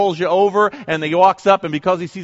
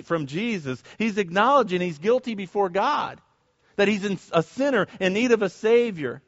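ordinary talk

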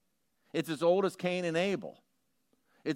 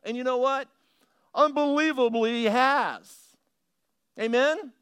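high, loud joyful preacher's proclamation; exultant preaching; 9 semitones higher, 7 dB louder than usual. Acted. Pastor rejoicing before the congregation, exultant preaching. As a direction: lift it up in celebration.

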